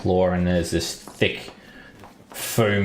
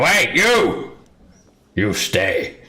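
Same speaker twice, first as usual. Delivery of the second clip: a lightly voiced drunk, shouting for more; drunk patron; 5 semitones higher, 6 dB louder, much slower than usual. Drunk sailor calls the bard back, mildly loud. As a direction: demand an encore, sloppy and eager.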